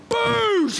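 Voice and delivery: high, loud "boosh" of a wave; explosive crash effect; booming crash noise. Acted. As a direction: imitate a wave slamming in. Imitates tidal wave crash.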